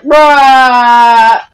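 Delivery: one word held out loudly, level pitch; long loud bruh. Retold or imitated. Kid replying to the pizza guy, long loud bruh. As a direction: drag it out as a loud mock comeback. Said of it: "then he was like".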